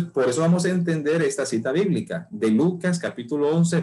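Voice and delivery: ordinary talk, nothing marked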